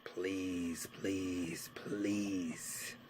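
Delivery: whispered pleading under the breath; desperate whisper. Retold or imitated. Lost man whispering a plea at a stranger's door. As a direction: beg in a hushed whisper, hoping it's the right door.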